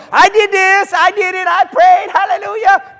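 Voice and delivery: high, loud mock crowing; hammed-up shrieking boast; boastful braggart. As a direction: crow it like someone showing off. Mocks boastful person after calm.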